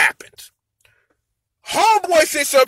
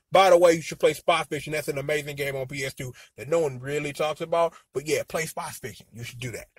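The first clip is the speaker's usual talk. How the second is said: low, much softer recommendation; quiet aside; 8 semitones lower, 8 dB quieter than usual. Real, not acted. Host slipping viewers a game tip, quiet aside. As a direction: drop low and tuck it in as an aside.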